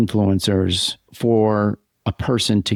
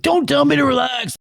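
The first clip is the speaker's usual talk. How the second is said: sharp, much higher pushback; irritated snap. Retold or imitated. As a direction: bristle at being told to relax. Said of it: "And you're like".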